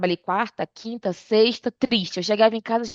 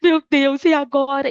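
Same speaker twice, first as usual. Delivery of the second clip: higher, louder mock tearful wail; mother on speakerphone after the crash, mock tearful cry; imitating crying mother; 6 semitones higher, 6 dB louder than usual. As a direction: mimic mom's distressed sobbing plea. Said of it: "E aí ela chorando".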